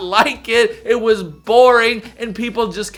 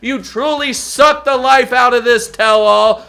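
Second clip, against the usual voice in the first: sarcastic shout; 8 semitones higher, 10 dB louder, slower than usual. Acted. Sarcastic praise of bad host.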